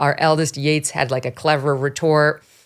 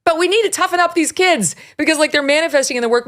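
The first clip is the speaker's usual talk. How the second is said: fired up; 10 semitones higher than usual. Real, not acted. Animated rant about kids.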